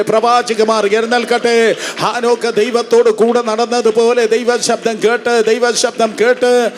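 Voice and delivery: rhythmic declaring prayer, building in pitch; impassioned revival preacher; full-force preaching. Real, not acted. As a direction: proclaim blessings over a crowd, rising steadily.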